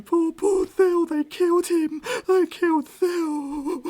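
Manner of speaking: high, loud, slow mock wailing; hammed-up wailing sob. Captive widow wails to the party over her murdered husband, hammed-up sobbing. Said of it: "She's crying".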